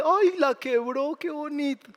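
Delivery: mock-sweet, high and slow; syrupy mock tenderness over a broken vase; tender like Winnie Pooh. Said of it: "todo tierno como Winnie Pooh".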